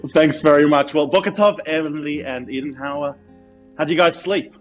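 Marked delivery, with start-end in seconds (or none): none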